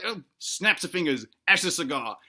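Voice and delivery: ordinary talk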